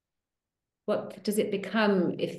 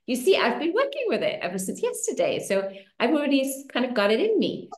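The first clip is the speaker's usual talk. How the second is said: usual speaking voice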